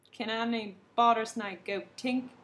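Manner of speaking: loud king. A narrator's reading. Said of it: "The king said".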